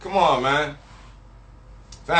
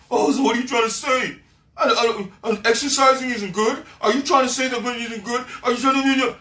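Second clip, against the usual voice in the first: higher-pitched, indignant imitation of a woman; mock offended woman; 6 semitones higher than usual. Woman takes his reassurance as criticism, mock-offended. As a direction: fire off stammered, offended questions in a higher voice.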